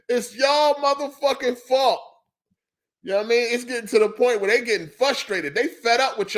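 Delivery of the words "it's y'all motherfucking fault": mock outburst. Game studios blaming absent buyers, hammed-up angry yell. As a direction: play a fed-up developer yelling at the players. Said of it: "They're like".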